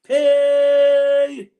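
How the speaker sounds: sudden high loud Hey; mock shout. Pretending to clear the road for Juliet, high loud shout. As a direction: yell it out like clearing a path. Calling out mockingly in rant.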